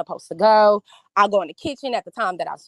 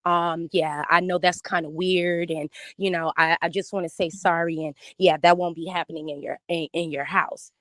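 imitating Eligio; 6 semitones lower, 4 dB quieter than usual. Eligio apologises on the phone.

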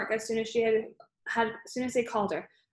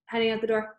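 ordinary delivery, nothing marked

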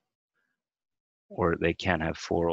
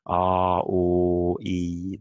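vowel sounds demo; 5 dB louder, much slower than usual. Shown as a demonstration. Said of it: "Vowels is the long sounds".

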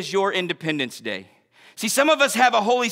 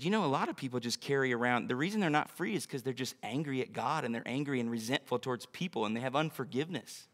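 very soft, low retelling; quiet confiding; 8 semitones lower, 13 dB quieter, faster than usual. Retold or imitated. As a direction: share a sober insight in a hushed, low voice. Retells her quiet, serious confidence.

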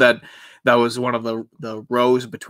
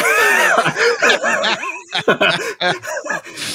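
sudden high shrieking burst of laughter. Real, not acted. Joke about the testimonial debate.

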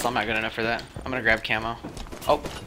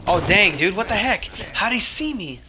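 surprised outburst; 9 semitones higher, 4 dB louder than usual. Real, not acted. Caught off guard by enemy.

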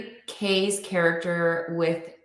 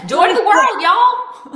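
put-on Southern accent; 10 dB louder than usual. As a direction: belt it out as a hammed-up Southerner. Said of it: "your Southern accent was phenom".

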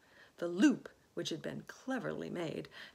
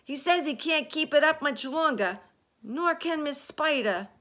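loud, high call from below; high-pitched urgent yell; 8 semitones higher, 12 dB louder than usual. A narrator's reading. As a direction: yell up with bad news. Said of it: "yelled the centipede from below".

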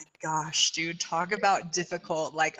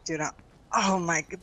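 normal talking voice

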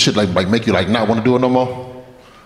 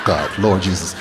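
low, softer mock-weary aside; deadpan dismay; 6 semitones lower, 4 dB quieter than usual. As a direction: drop low in stunned disbelief. Stunned friend reacting to story.

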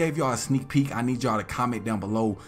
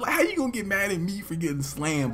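put-on pitched-up indignant kid; mock outraged kid; kid protesting; 9 semitones higher than usual. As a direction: complain in a lightly raised voice. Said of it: "I'm like".

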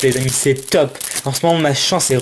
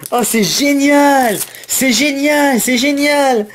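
thrilled exclaiming; 8 semitones higher, slower than usual. Real, not acted. Pulls a rare full art card.

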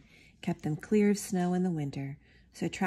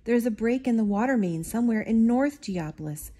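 higher and louder, urgent report; urgent call; 5 semitones higher, 6 dB louder than usual. Acted. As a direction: call out the bad news. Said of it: "called out the superintendent".

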